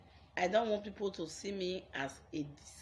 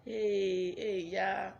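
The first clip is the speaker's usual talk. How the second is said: dismissive mockery; 3 semitones higher, 4 dB louder, much slower than usual. People jeering at her struggle, mocking and dismissive. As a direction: jeer at someone who is struggling. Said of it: "you are saying".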